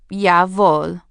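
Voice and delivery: loud mock-German reply; hammed-up German obedience; bad German accent. A narrator's reading. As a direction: bark it in a fake German accent. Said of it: "Must you murder the German language?".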